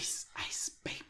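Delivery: breathy whispered song quote. Pretend. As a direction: whisper the hook. Quoting [name].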